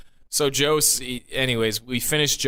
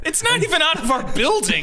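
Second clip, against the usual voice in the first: exasperated; 3 dB louder than usual. Real, not acted. Exasperated telling of absurd order.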